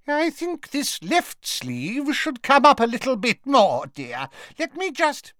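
very high, fluttery shopkeeper voice; fussing seamstress. A narrator's reading. Madam Malkin adjusting a customer's sleeve, nervously fussing. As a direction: fuss politely in a high-pitched voice. Flustered seamstress pretending nothing is happening.

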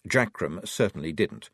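ordinary delivery, nothing marked

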